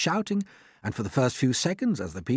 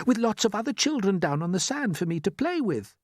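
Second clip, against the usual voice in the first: eagerly; 8 semitones higher than usual. Acted. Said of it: "said James eagerly".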